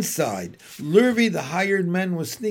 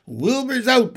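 high, louder burst of news; excited announcement; 8 semitones higher, 5 dB louder than usual. A narrator's reading. Animals spread exciting news.

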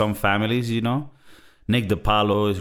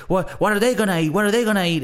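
higher, lightly voiced Mark scolding; imitating Mark; 8 semitones higher, faster than usual. Retelling Mark's scolding scene, lightly voiced imitation. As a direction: mildly mimic the brother telling him off. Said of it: "And then he's like".

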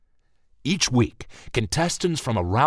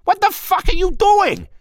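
very high, loud furious shout; explosive yell; 8 dB louder than usual. Acted. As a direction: explode at him in panic. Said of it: "Marty exploded".

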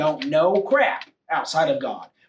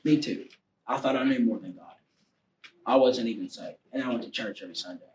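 low, soft confession; subdued personal testimony; 8 semitones lower, 5 dB quieter than usual. Real, not acted. Preacher confessing a past mistake to the congregation, low and subdued. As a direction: drop the voice and admit it quietly.

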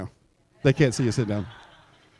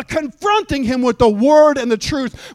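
ordinary talk, nothing marked

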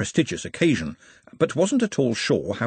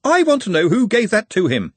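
higher and louder exasperated shout; 9 semitones higher, 5 dB louder than usual. A narrator's reading. Ponder Stibbons shouting about the megaphone, exasperated. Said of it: "Ponder Stibbons shouting".

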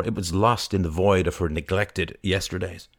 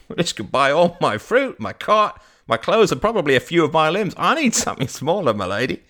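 high, loud, incredulous amusement; laugh, then exaggerate merrily; laughing old vendor; 8 semitones higher, 5 dB louder than usual. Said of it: "The man laughed".

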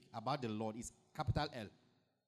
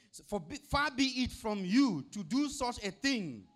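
pleading Abraham; 6 semitones higher, 5 dB louder than usual. Acted.

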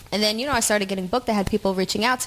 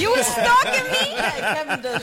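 laughing; much slower than usual. Real, not acted. Joking accusation, laughing.

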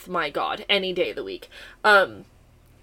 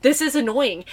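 irritated; 5 semitones higher, 6 dB louder than usual. Retold or imitated. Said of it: "I don't get irritated like that".

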